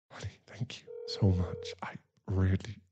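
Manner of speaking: soft, low, breathy; whisper. Acted. To his partner on the couch, whispering thanks. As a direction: murmur it close and tender.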